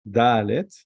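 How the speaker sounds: ordinary talk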